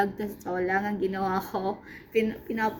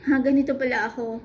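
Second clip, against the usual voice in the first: louder, higher amused exclamation breaking into laughter; amused disbelief dissolving into a high laugh; laughing at herself; 5 semitones higher, 5 dB louder, faster than usual. Real, not acted. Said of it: "natatawa ako sa sarili ko".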